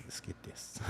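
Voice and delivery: low-volume, whispered aside; hushed retold quote. Tattooer voicing his old careless self, in a hushed aside. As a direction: drop to a near-whisper for the quote.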